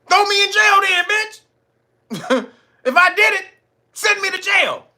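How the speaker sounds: high loud taunting dare; hammed-up daring voice; mock defiant challenge. Voicing [name]'s challenge.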